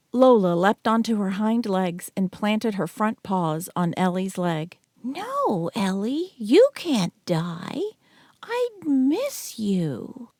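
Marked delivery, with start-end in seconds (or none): 5.0-10.3 small dog's voice, high and slow, begging; high, slow dog voice begging its owner not to die; pleading little dog. A narrator's reading. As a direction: plead like a devoted pup.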